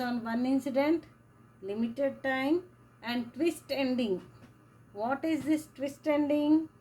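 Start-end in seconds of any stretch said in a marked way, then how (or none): none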